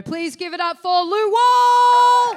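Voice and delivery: loud, high, drawn-out announcer call; rousing stage introduction. Real, not acted. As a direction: whip up applause, stretching the name. Host introduces comedian to applause.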